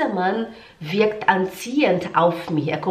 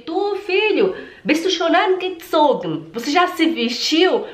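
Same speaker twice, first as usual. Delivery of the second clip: put-on parent to child; 9 semitones higher than usual. Parent asking a son about dressing, high-pitched calling. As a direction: check on your kid in a raised, high voice. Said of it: "você fala pro seu filho".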